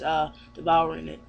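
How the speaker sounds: normal talking voice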